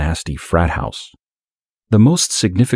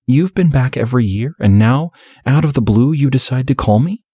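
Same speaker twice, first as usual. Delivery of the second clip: put-on higher, suspicious young woman; 8 semitones higher than usual. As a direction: challenge him, higher and pointed. Beth confronts Derek.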